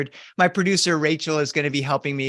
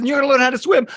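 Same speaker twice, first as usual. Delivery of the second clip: mother's firm insistence; 10 semitones higher, 6 dB louder, much faster than usual. Retold or imitated. Mother insisting to her child.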